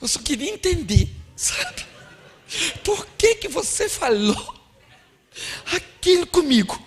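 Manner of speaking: high, halting, wounded mock plea; hurt wife. Wife questioning husband after the quarrel, hammed-up hurt. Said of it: "a senhora fala pra ele".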